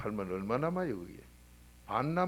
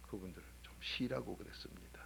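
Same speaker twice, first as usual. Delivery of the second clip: whisper; 4 semitones lower, 9 dB quieter than usual. Retold or imitated. Retold kind reply to drivers.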